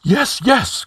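high-pitched flustered cry. A narrator's reading. Flustered High Counselor to the snapping commander, crying out. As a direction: cry it high and hurried in manner. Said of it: "cried Publius".

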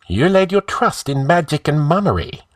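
careless. Acted. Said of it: "answered Escalante carelessly".

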